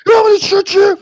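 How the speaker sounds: screaming high and loud at them; furious high-pitched shouting; yelling. Acted. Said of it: "And Hank is like".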